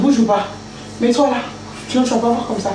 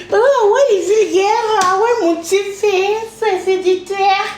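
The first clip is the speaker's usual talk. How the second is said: mocking voice; 7 semitones higher than usual. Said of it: "Je leur dis".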